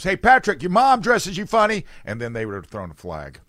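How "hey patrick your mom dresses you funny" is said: high, loud heckle across the field; taunting yell. Acted. Player heckles the star quarterback across the field, taunting yell. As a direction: yell a silly schoolyard taunt. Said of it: "somebody could have yelled at Patrick Mahomes".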